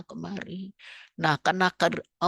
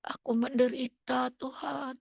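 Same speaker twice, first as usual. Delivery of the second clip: mild mock cry; 5 semitones higher, 4 dB quieter than usual. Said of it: "Dia cuma menjerit".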